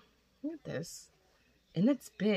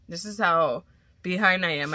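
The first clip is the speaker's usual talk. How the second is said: ordinary talk, nothing marked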